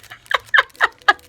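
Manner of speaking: high, loud burst of laughter. Real, not acted. Crafter laughing at her own puffy-sticker freakout, loud burst. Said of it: "I just do this every time".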